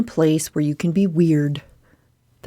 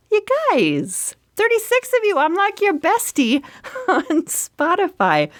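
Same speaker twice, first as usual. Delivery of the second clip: thrilled gush; 13 semitones higher than usual. Real, not acted. Thrilled about being listeners' top podcast.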